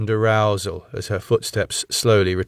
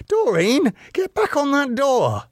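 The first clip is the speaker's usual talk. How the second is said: very high-pitched bossy call; exasperated order. A narrator's reading. Husband calls wife back to the door, exasperated.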